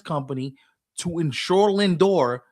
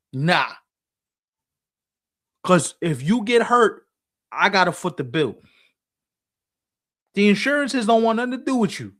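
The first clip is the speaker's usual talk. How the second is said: indignant team owner; 3 semitones higher than usual. Acted. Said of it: "These owners are looking at these Latin players going".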